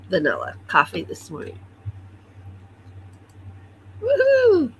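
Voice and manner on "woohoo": excited cheer. Real, not acted. Cheering over her coffee.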